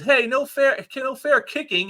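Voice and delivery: villain whining at a dirty kick; whiny protest. Acted. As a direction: complain like a kid cheated at play.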